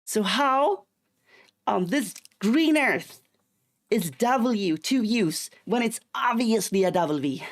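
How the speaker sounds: loud, high, drawn-out disbelief; hammed-up outrage, hitting words hard; exasperated rant. Streamer demanding why W is called double U, exasperated. Mock rant about W's name.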